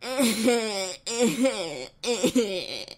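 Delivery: mock evil laugh. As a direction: cackle like a villain. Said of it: "the most evil lull I ever heard".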